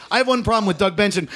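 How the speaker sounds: normal talking voice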